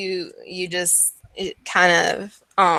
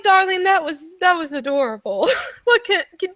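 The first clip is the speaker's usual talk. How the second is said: put-on high-pitched, patronizing old woman; amused old lady; 11 semitones higher, faster than usual. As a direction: coo sweetly at a silly child. Game master voices amused old pastry seller.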